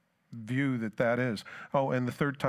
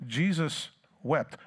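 ordinary talk

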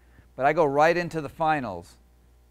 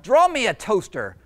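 high, loud monster demand; growling monster; 9 semitones higher, 9 dB louder than usual. Acted. Monster growling orders at Jeremy, loud and bossy. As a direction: bark the order like a spoiled monster. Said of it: "the monster growled".

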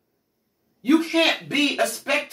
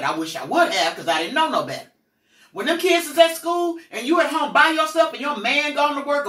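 ordinary talk, nothing marked

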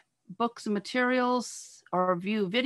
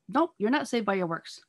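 usual speaking voice

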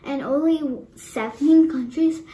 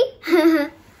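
a bright burst of laughter; amused giggle after the joke; short laugh; 6 dB louder than usual. Real, not acted. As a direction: laugh at your own pun. Laughs at own riddle joke.

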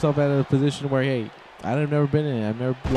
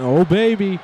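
excited call; 5 semitones higher, 5 dB louder, slower than usual. Real, not acted. Commentator hyping the big move, rising excited calls. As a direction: build the hype to a shout.

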